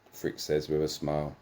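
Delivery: ordinary talk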